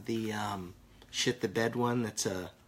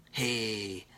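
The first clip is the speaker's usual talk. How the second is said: normal talking voice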